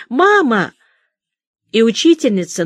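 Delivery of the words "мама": a lost last cry for mother, louder and higher; hopeless, desperate call. A narrator's reading. Child calls for mama one last time, desperately. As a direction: call out as if she is already gone. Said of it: "безнадёжно и отчаянно".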